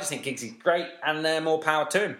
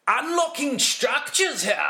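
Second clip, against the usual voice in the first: higher, slow, quirky commentator voice; imitating Giggsy; 11 semitones higher, slower than usual. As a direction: mimic the quirky commentator savouring each word. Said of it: "and he'll go".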